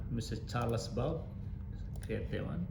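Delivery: ordinary talk, nothing marked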